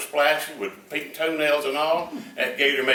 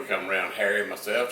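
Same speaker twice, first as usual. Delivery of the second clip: ordinary talk, nothing marked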